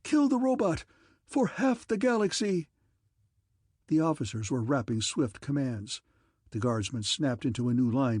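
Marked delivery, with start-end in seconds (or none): 0.0-2.7 a fleeing emperor screaming for rescue; panicked scream. A narrator's reading. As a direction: shriek in terror at the guardsmen. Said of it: "he screamed breathlessly".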